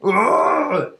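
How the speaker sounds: loud, high "Arr!" cry; acted roar of pain. Game master voices Ender heard from behind a tree, roaring. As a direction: roar out like someone hurt out of sight. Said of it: "you hear this".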